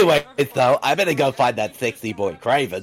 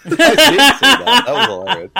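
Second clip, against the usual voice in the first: laughing; 9 semitones higher, 6 dB louder than usual. Real, not acted. Laughing at the film.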